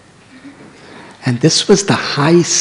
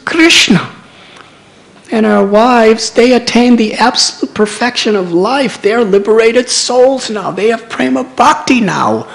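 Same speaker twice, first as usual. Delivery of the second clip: much higher and louder, ashamed outcry; embarrassed lament; 7 semitones higher, 7 dB louder than usual. Retold or imitated. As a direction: cry out your regret at missing the point. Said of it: "they were so embarrassed".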